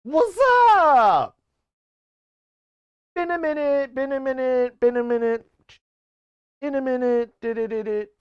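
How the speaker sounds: high, put-on squeaky voice, loud on the first line; silly high greeting voice. Streamer greeting chat in a squeaky put-on voice. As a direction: greet chat like a goofy cartoon character.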